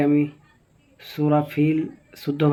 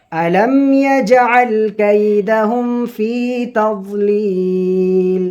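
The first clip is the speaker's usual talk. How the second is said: slow, high melodic chanting of the verse; Quran recitation; 4 semitones higher, 5 dB louder, much slower than usual. Shown as a demonstration. Teacher opening the Surah lesson with slow high recitation.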